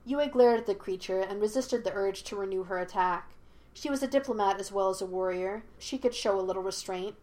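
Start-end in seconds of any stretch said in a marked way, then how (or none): none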